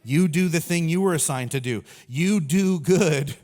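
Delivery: loud, emphatic God giving orders; God's firm command. Acted. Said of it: "And he's like".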